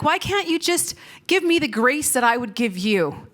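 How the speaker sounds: ordinary talk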